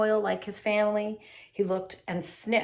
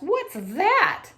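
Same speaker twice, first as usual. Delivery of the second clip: high, loud alarmed ask; startled question; 11 semitones higher, 6 dB louder than usual. Acted. Beach attendant startled, high and loud. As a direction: point and demand an answer.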